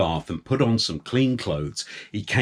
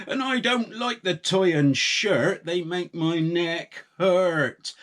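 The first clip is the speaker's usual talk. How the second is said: put-on childish whine, high and drawn out; lightly voiced grumbling farmer; whiny farmer; 7 semitones higher than usual.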